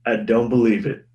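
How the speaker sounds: usual speaking voice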